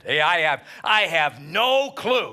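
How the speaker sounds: exasperated prophet. Acted. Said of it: "And he is saying".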